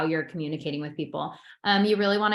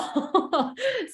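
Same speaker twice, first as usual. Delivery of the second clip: high laugh; 12 semitones higher than usual. Real, not acted. Laugh after vacuum joke.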